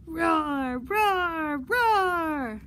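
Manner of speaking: three loud roars, each falling away; dinosaur roaring. Acted. Librarian reading the dinosaur to children, roaring repeatedly. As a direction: keep roaring, big and playful. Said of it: "He's roaring".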